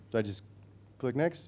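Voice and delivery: ordinary talk, nothing marked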